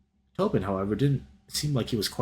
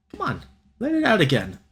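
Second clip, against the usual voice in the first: high, louder teasing push; playful egging on; 10 semitones higher, 4 dB louder than usual. Real, not acted. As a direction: egg him on playfully. Reader reacting, encouraging.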